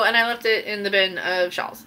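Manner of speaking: usual speaking voice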